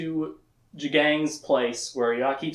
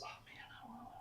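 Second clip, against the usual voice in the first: hushed unvoiced whisper; 30 dB quieter, much faster than usual. Boys whispering in the cabin, a whisper. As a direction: whisper it so no one hears. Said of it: "and, like, whisper".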